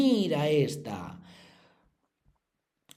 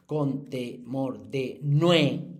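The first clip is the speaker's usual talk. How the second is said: counting syllables; much slower than usual. Shown as a demonstration. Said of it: "Si contáis las sílabas".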